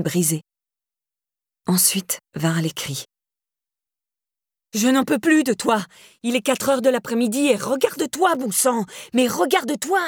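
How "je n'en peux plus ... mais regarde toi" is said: high, strangled, at the end of his rope. A narrator's reading. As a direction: choke out the words, pushed past breaking point. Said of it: "La voix de Daniel était aiguë, étranglée".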